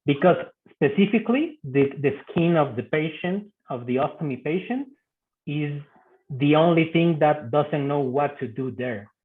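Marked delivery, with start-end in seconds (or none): none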